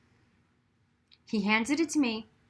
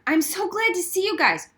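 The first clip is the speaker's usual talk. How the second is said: eager, higher and louder; relieved greeting; 8 semitones higher, 5 dB louder than usual. A narrator's reading. Girl greeting Donovan's friends, eager relief. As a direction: gush with relief.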